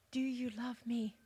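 soft whispered question, almost breathless; tender whisper. Acted. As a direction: ask it gently, barely voiced. Jesus asks Peter tenderly.

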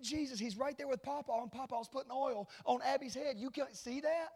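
high-pitched imitation of an insistent little boy; excited six-year-old. Young son insisting that Dad look, high excited child voice. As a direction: play an amazed child pointing something out. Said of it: "At the time, he's six".

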